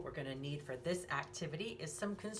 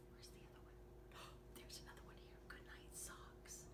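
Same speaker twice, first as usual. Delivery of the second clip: whisper; 22 dB quieter than usual. Acted. Finding the second clock with the child, whispered. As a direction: whisper it like a secret find.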